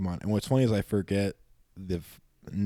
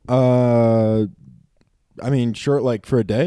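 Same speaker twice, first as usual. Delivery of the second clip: hesitant agreement; 9 dB louder, slower than usual. Retold or imitated. Retelling his reluctant agreement.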